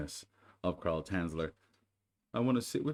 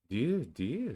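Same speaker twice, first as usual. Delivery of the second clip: higher sympathetic bear; lightly higher Pooh voice; 6 semitones higher, 4 dB louder than usual. Acted. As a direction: cluck over him kindly.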